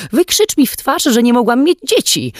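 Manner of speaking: high, loud hysterical scream. A narrator's reading. Wife screaming at husband about not having children, hysterical. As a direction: scream it at him, wounded. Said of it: "wrzasnęła".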